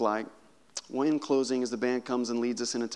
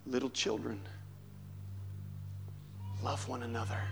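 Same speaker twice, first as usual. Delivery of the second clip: put-on weak old voice; 2 semitones lower, 9 dB quieter, faster than usual. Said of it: "look at them and say".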